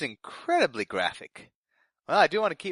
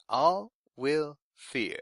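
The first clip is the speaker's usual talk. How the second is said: slow ominous; much slower than usual. Acted. Ominous decree read aloud on air.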